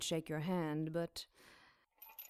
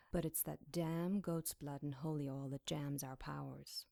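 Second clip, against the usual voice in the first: usual speaking voice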